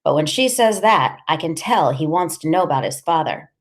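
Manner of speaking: ordinary delivery, nothing marked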